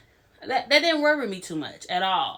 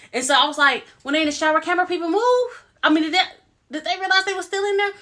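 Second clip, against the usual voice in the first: high and loud, faster at the end; worked-up disbelief; excited; 8 semitones higher, 4 dB louder, faster than usual. Real, not acted. Excited disbelief about shower scene.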